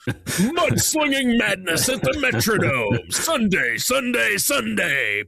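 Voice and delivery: booming high slow monster truck announcer. Pretend. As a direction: hype the rally like a radio spot. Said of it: "you should do the monster truck ads".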